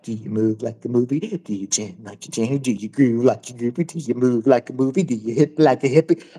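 low, monotonous pestering chant; teasing needling. Retold or imitated. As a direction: drone it low to annoy someone. Retelling his needling chant behind girl.

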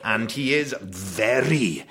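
very slow, stretched-out posh Welsh. Shown as a demonstration. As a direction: imitate a Welsh dad putting on airs, word by word. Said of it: "he puts on a rather posh voice".

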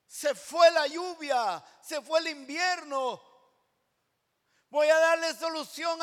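God's voice proclaimed to the beloved; preaching at full force. Real, not acted. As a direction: pour it out over the congregation.

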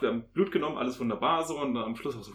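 normal talking voice

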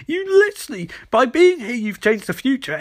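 high-pitched acted indignation, easing down; mock outraged argument. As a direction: start very high in exasperation, then settle. Barry argues heatedly with Elbard.

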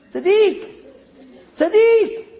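high exclaimed praise; excited praise. Retold or imitated. Rabbi praising the soldier, high and excited. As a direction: cry it out in delight.

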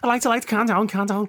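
Scouse impression. Mocking a Scouse stereotype.

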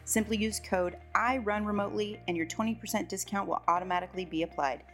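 smooth announcer read over music; podcast intro read. Acted. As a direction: read it like a polished show opener.

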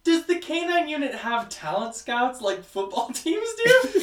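high, laughing ridicule; amused mocking disbelief; laughing mock question.

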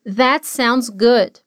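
slow, careful phrasing; slow model. Shown as a demonstration. Teacher modeling a phrase for students to repeat. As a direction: give each word time to land.

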